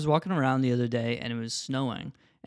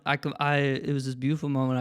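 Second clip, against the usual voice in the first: ordinary talk, nothing marked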